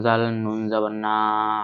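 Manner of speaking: usual speaking voice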